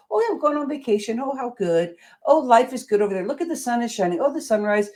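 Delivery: playful imitation of sunny optimism; bright cheerful gushing. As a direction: gush like life is suddenly sunny. Imitating her rare glimpses of the good life.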